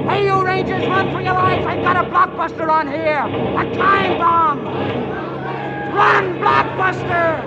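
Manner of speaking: high, loud shouting across the woods; calling out. Acted. Soldier shouting a warning to the rangers in the woods. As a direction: bellow a warning from afar.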